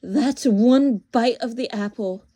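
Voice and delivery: loud, berating witch voice; exasperated witch. Acted. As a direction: hammer the point at a foolish boy, loudly.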